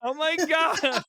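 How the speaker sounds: loud, high, slow laughing gasp; laughing disbelief. Real, not acted. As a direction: stretch it out through laughter.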